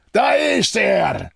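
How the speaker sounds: high, drawn-out yell; bellowed shout. A narrator's reading. Someone outside announces the man found, bellowing. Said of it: "brüllte jemand von draußen".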